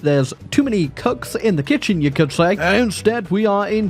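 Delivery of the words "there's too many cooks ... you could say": usual speaking voice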